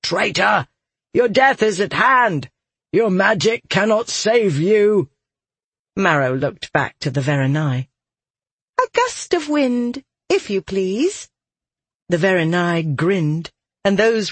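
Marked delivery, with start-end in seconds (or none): 0.0-5.1 raised, threatening mob cries; shouts of alarm. Acted. Mob threatens the escaping traitor, shouted alarm. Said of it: "Their shouts of alarm pierced the night". 8.8-11.3 high, politely commanding character voice; playful childlike captain. Acted. Marrow asks the Verenai for wind, high playful order.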